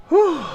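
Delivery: winded exhale after running; puffing out a relieved whew; breathless whew. Real, not acted. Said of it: "a little out of breath".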